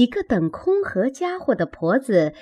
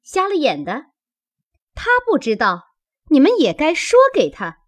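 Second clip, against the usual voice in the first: sharp, higher-pitched telling-off; scolding; 8 semitones higher, 5 dB louder, faster than usual. A narrator's reading. Maid berates the young servants for letting her in, scolding. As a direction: tell them off with rising pitch and force. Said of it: "一面又骂小丫头们".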